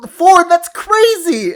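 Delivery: high, slow, stretched-out amazement; mock amazement. To a four-year-old on the trail, high drawn-out amazement. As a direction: marvel at it like it's huge news. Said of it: "I was just like".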